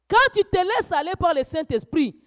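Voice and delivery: loud, high fervent preaching; preaching at full force. Acted. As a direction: rouse the congregation, voice climbing. Preaching rises again.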